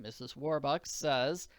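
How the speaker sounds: ordinary delivery, nothing marked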